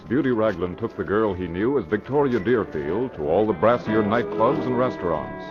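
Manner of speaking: ordinary talk, nothing marked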